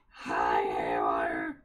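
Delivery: very low, growly silly hello; deep monster greeting. Pretend. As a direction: greet your friend like a cartoon beast. Greeting Haywire again, silly voice.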